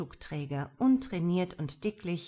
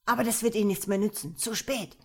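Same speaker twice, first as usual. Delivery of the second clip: fast, louder defiant taunt; defiant rush; 3 semitones higher, 4 dB louder, faster than usual. Acted. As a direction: spit it out quickly, sure of winning. Leader defiant while spotted.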